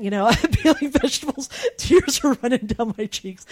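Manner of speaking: amused at herself as she remembers; laughing. Real, not acted. Telling a friend about her cry day, laughing.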